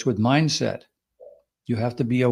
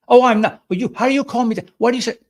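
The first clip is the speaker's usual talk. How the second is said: much higher and louder, flustered and fast; defensive overreaction; 11 semitones higher, 6 dB louder, faster than usual. Shown as a demonstration. Host acting out the wrong way to react to an insult, flustered. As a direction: sputter back as if stung. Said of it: "Don't react".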